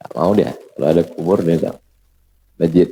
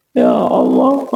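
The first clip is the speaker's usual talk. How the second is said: drawn-out, low tearful plea; mock weeping; 6 semitones lower, slower than usual. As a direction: imitate someone crying out to God. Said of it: "dia menangis".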